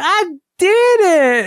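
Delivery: put-on high, slow, delighted little-kid "I did it"; adorable toddler voice. Dad imitating his son's proud toddler cheer. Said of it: "the most adorable voice".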